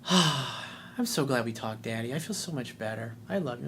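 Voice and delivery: put-on soft, relieved thanks as the girl; sweet daughter. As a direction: daughter thanking her dad after a talk, softly relieved. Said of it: "she's like".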